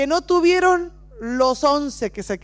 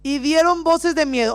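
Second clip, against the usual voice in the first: dramatic reading; 3 semitones higher, 3 dB louder, slower than usual. Acted. Reads disciples' fright dramatically.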